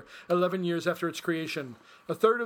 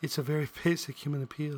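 man lightly voicing a woman; a hint of a woman's voice, laughing; girl voice; 4 semitones lower, 3 dB quieter than usual.

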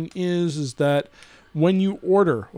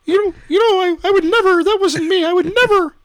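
much higher prim voice, playing innocent; high mock innocent denial; 13 semitones higher, 5 dB louder than usual. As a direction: squeak out the denial like a prim little angel.

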